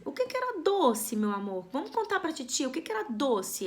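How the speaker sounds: high, coaxing question to a little one; talking to a small child, a teacher asks sweetly. Retold or imitated. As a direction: coax the child gently like a preschool teacher. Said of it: "Você fala assim".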